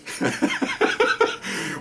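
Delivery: soft unvoiced chuckle; breathy laugh. Real, not acted. Storyteller laughing at how funny it was, soft airy chuckle. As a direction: laugh under the breath at the memory.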